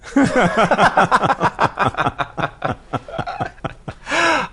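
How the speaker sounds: long, high wheezing laugh; real helpless laughter tapering off; laughing hard. Real, not acted. Laughing after reading the letter.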